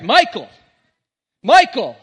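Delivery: acted shout for a lost child; high, loud parent's yell, imitated. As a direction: call the name out loud across a store. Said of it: "they're yelling in the store".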